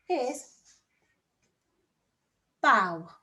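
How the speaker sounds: ordinary delivery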